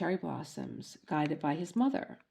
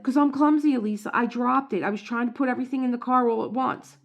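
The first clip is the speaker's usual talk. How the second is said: raised, loud defensive husband; husband's excuse; 6 semitones higher, 8 dB louder, faster than usual. Retold or imitated. Husband explaining he dropped the cup, loud and defensive. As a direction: blurt the excuse, fast by the end. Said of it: "And he's like".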